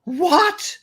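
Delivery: high shocked "What?" in a British lilt; mock the shocked British wife. Retelling the wife hearing news, high British shock. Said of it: "his wife, who was British, was like".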